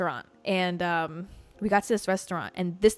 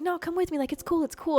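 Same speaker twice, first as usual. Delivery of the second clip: mock coaxing driver; 8 semitones higher than usual.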